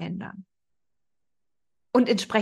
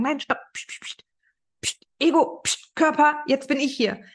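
higher-pitched, sharp hushing; firm shushing; 5 semitones higher than usual. Acted. Higher self shushing Ego and body, firmly. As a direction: silence them and take charge.

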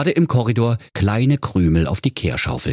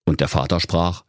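ordinary delivery, nothing marked

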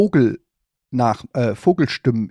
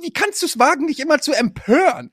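mock outrage; 13 semitones higher than usual.

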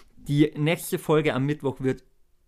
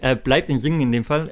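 ordinary talk, nothing marked

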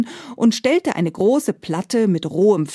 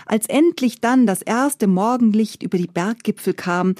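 ordinary delivery

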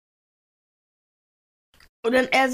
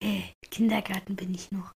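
retold whisper; 4 semitones lower, faster than usual. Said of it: "und er so".

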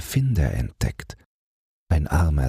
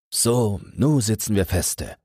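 higher, slow colloquial grumble; overheard passenger; 6 semitones higher than usual. A narrator's reading. Someone in the stopped train, overheard, higher and slow. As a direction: drag it out like a stranded traveller. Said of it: "Da hört er jemanden sagen".

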